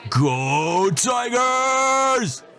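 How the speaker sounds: rally the whole party with it; roared. Acted. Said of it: "He roared".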